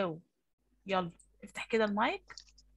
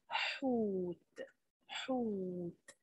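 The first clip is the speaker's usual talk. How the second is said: the vowel in حوت stretched very slowly; drawn-out vowel; 4 dB quieter, much slower than usual. Shown as a demonstration. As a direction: stretch the long waw in حوت. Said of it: "الكلمة دي فيها إيه؟". (مد الواو في حوت ببطء شديد)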